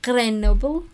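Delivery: put-on Grenoble said with a French accent. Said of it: "voy a hacer mi acento francés".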